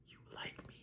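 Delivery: stunned hushed whisper; whispered amazed question. Retold or imitated. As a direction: breathe it out in disbelief. Surprised they both said red.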